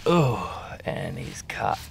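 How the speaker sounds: normal talking voice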